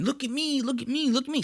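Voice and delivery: put-on high, soft childish whine; a kid showing off for attention; needy kid. Comic mimicking a kid begging the audience to look. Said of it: "a kid getting on stage and going".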